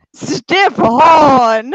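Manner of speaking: loud, high crotchety screech; drawn-out crotchety scream; crotchety sorting hat. Acted. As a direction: bellow it like a cranky old magic hat. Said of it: "like, really crotchety ass voice".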